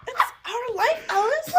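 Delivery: very high, hammed-up plea through laughter; Fred Armisen. Comedian pleading with Alice in the movie, laughing hammed-up impression. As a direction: crack up while imitating an overwrought husband. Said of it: "Fred Armisen".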